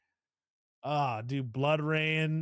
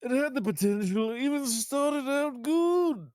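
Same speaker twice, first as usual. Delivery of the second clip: mock crying; 7 semitones higher than usual. Hammy mock sob in snobby read.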